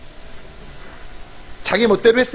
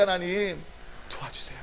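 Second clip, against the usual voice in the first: much softer, lower, almost breathed; hushed plea; 3 semitones lower, 13 dB quieter than usual. Pretend. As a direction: drop to a small desperate prayer. Pleading prayer after the cry. (하나님께 매달리는 작은 목소리)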